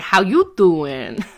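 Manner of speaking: slow, drawn-out Brooklyn how you doing; a lightly voiced Brooklyn greeting, stretched; a hint of a Brooklyn accent, drawled. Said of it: "in Brooklyn, it's like".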